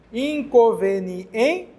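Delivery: "Inconveniente" drawn out very slowly, rising at the end; teacher slowly sounding out "Inconveniente"; slow demonstration. Shown as a demonstration. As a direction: stretch "Inconveniente" out for the class. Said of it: "Onde recai a sílaba tônica".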